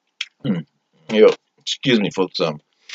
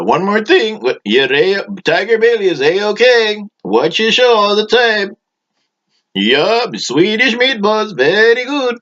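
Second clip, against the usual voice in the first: high, slow, gushing fan voice; eager audience member; 12 semitones higher than usual. Acted. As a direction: pitch it way up like an eager fan in the crowd. Audience member praises the show.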